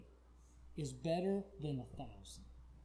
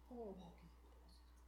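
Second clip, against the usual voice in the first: very soft, spent end of a plea; hushed prayer; 18 dB quieter than usual. Acted. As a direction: drop to a near whisper. Acted prayer sinks to hush.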